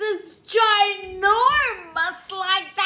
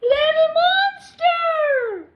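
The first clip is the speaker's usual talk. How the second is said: high, loud, long drawn call; 10 semitones higher, 7 dB louder, slower than usual. Acted. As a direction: shout it across the forest. Calling out.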